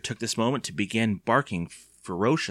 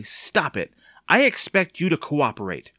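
higher, firm scolding; angry shout; 6 semitones higher, 4 dB louder than usual. A narrator's reading. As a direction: raise the voice to scold a child. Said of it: "shouted angrily".

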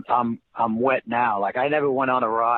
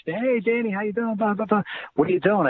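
cheerful coach voice, much higher; Rick Pitino; 9 semitones higher than usual. Retold or imitated. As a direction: play the upbeat coach picking up the call.

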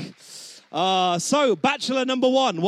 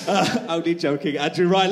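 ordinary delivery

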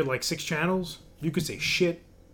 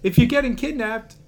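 cartoon voice; 7 semitones higher, 3 dB louder than usual. Repeats quote in character.

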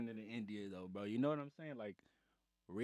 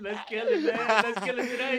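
hype; 8 semitones higher, 8 dB louder than usual. Real, not acted. Hyping up the show.

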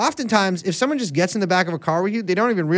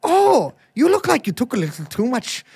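bad Irish accent; 5 semitones higher than usual. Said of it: "you have to say the Lucky Charms".

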